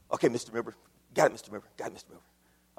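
low, soft, sleepy mumble fading out; a lightly voiced worn-out driver, mumbling; navigator's reply. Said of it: "I said to the shaky navigator".

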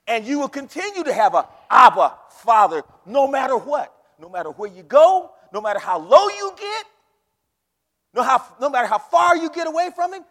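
loud, rising revival preaching; preaching at full force. Acted. Pastor assuring the congregation, full-force preaching. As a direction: lift it up and drive it home.

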